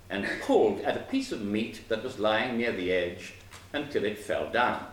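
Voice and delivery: ordinary delivery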